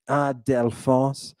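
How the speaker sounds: ordinary delivery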